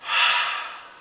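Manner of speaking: audible calming exhale; deep breath out. Shown as a demonstration. Before asking a crush out, a steadying exhale. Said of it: "Take a deep breath".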